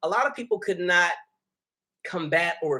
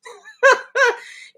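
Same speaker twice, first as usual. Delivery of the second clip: high, loud cackling laugh; real high-pitched laughter; 8 dB louder than usual. Real, not acted. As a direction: crack up at your own joke. Laughs at people in long lines.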